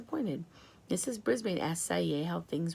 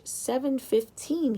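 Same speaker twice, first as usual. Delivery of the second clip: careful answer; 6 semitones higher, 3 dB louder, slower than usual. Acted. Dad practicing English.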